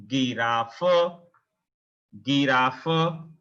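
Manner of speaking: "Giraffe" with hard g and sounded final vowel; German pronunciation. Shown as a demonstration. Teacher models German G for learners, German pronunciation.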